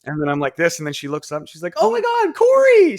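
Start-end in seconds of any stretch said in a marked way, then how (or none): 1.8-3.0 very high girl imitation, rising to loud; mock shocked squeal. Birthday girl recognizing the stripper, mock shocked squeal. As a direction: squeal the name in falsetto surprise. Shocked bride-to-be acted in story.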